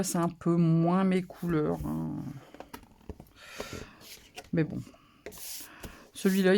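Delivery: ordinary talk, nothing marked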